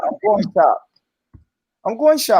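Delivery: mock British accent. American teasing British friends' phrasing, mock British accent. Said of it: "Uh, what y'all say?".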